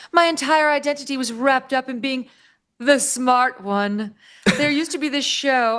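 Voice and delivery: high mocking celebrity voice; mock Meghan voice. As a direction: lightly mimic a self-satisfied celebrity.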